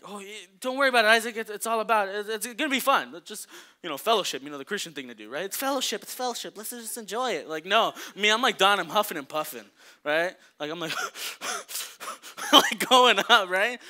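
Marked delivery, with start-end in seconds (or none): 0.0-7.5 fast, loud, upbeat brush-off; mock cheerful friends. Friends urging Isaac on, hammed-up cheer. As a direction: sell it as fun fellowship.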